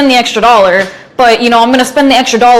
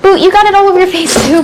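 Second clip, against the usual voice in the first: high fast teasing voice; teasing put-on voice; 7 semitones higher, much faster than usual. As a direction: rattle it off high and mocking.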